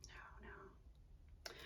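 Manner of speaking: faint whispered no; hushed self-correction. Real, not acted. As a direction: barely breathe it. Muttering to herself.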